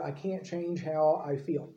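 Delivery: mock helplessness, slow and subdued; resigned excuse. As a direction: drag it out quietly, like a helpless excuse. Voicing a resigned common excuse.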